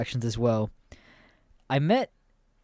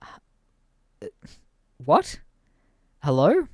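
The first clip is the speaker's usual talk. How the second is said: stunned disbelief; 5 semitones higher, much slower than usual. Retold or imitated. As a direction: react like you can't believe it. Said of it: "And we're like".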